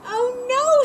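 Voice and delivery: high, loud oh no; alarmed oh no; dismayed cry. Acted. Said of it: "said Amelia Bedelia".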